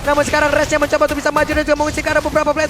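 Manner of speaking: fast live play-by-play; esports caster. Acted. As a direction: call the action quickly.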